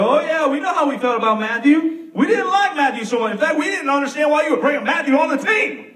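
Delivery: high, loud, animated group voice; disciples chiming in. Acted. Disciples telling Jesus about Matthew, high and lively. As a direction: pile on with the crowd.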